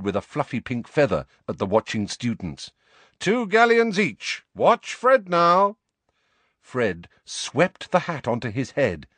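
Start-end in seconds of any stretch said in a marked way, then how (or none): 3.2-5.8 high, slow showman's patter; hawker's shout. A narrator's reading. George sells hats to watching students, shouted. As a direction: call out to the crowd, stretching the words.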